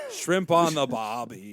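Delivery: slow, drawn-out fake Aussie; mock Australian accent. Host feeding key phrases to co-host, mock Australian accent. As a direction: lay on a cartoon Australian accent.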